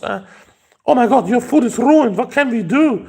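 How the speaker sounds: high-pitched panicky waitress pleading with a customer; panicked waitress. Retold or imitated. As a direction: play the flustered waitress begging forgiveness. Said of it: "waitress came to me and was like".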